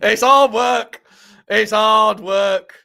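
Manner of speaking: loud, slow, hammed-up Nigerian accent in a Sean Dyche growl, laughing at the start. Streamer doing a joke voice for chat, loud slow mock Nigerian accent. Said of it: "Nigerian accent in Sean Dyche voice".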